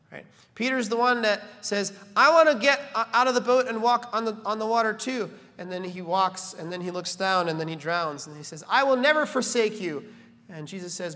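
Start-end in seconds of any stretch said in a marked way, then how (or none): 2.2-5.3 bold, loud, higher eager boast; a keen Peter voice, louder and higher. Retold or imitated. 8.7-10.0 loud, higher bold promise; a confident Peter pledge; brash vow. Retold or imitated.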